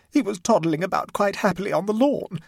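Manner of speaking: high, loud tearful wail. A narrator's reading. Distraught mother explains how her child went missing, tearfully. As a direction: tell it through rising tears. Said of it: "said Missus Mumby tearfully".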